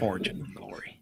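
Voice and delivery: very soft, low whispery line; whispered movie quote. Retold or imitated. Podcaster quoting the film hero on treasure, whispered. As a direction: half-whisper it, dreaming of treasure.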